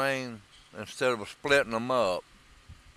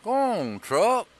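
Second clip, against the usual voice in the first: urging on; 9 semitones higher, 3 dB louder, slower than usual. Real, not acted. Coaxing a stuck truck along.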